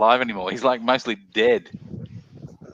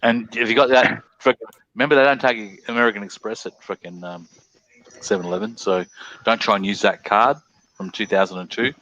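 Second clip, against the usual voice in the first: usual speaking voice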